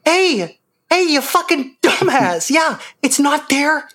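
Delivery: put-on high, slow old librarian voice; Jocasta Nu voice. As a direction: sneer like a fed-up old librarian. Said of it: "how a fucking old librarian who hates her job would talk".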